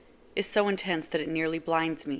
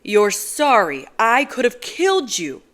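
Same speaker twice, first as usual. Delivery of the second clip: louder, higher enraged yell; furious shouting; 5 semitones higher, 10 dB louder than usual. Acted. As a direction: explode at her. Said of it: "I'm still shouting".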